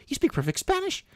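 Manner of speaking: high-pitched retold question of disbelief; acted incredulity. Shop owner questions a man calling himself American, acted incredulity. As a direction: replay your disbelief, pitched well up.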